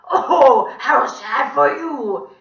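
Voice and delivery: loud, high, drawn-out mock pity; terrible villain voice. A narrator's reading. Villain taunting a crying child, creepy. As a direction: squawk it slowly, dripping with false sympathy. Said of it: "he said in his terrible voice".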